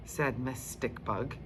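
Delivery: usual speaking voice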